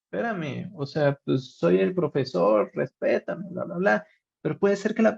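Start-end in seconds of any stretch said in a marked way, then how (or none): 0.1-4.1 mock indignant teacher. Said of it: "Y entonces digo".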